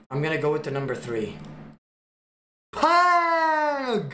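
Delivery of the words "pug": loud, very high stretched yell; a high, drawn-out yell of "Pug"; excited callout. Acted. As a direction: shout "Pug" out long and high. Calling out a chosen number.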